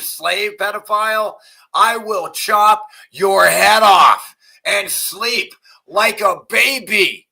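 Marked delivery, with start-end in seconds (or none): 1.7-7.2 loud, slow, menacing threat. Real, not acted. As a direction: spell out the threat slowly and loudly. Threatening rant at politicians.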